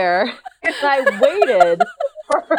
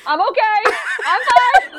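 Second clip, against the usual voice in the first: high squeaky fake bravery; high, laughing mock brave; 10 semitones higher than usual. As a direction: insist you're fine through laughter. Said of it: "So she's like".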